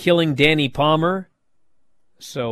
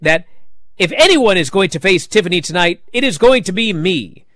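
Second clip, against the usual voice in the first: higher, louder, overly formal wrestler promo; stiff scripted promo voice; 5 semitones higher, 5 dB louder than usual. Retold or imitated. Podcaster mocking Dana Brooke's robotic ring promo, stiff and overdone. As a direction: recite it like a stilted scripted promo. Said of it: "incapable of talking like a normal human being".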